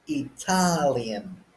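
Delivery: Italian drawn out slowly on TAL; Italian, slow with the stress on TAL; stress on second syllable. Shown as a demonstration. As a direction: stretch the middle of Italian, i-TAAL-ian. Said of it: "Check how the stress changes".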